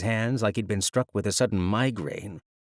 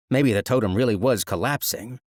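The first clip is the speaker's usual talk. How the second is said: usual speaking voice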